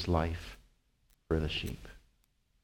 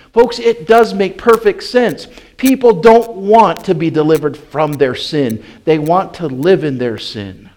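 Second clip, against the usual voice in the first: loud, high, driving pulpit voice; full-force preaching; 9 semitones higher, 12 dB louder than usual. Acted. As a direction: preach it hard to the congregation.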